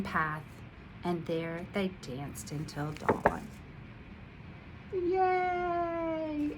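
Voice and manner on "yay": high happy cheer. Real, not acted. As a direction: cheer at the end. Reader cheers.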